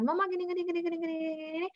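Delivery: put-on high, quick child's chatter; lightly voiced toddler rambling; imitating a child. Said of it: "kalau kita dengarkan".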